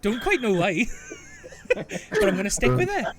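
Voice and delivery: put-on Welsh accent. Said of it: "now I've gone a bit Welsh".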